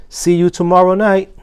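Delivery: bright, lifted farewell; high cheerful goodbye. A narrator's reading. Janitor waving goodnight to the pets, high and cheery. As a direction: call it out cheerily on the way out.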